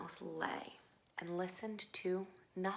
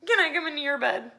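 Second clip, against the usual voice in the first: high, loud, quick begging; pleading little mouse; 7 semitones higher, 12 dB louder, faster than usual. A narrator's reading. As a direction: beg fast in a small high voice. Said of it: "asked Little Mouse".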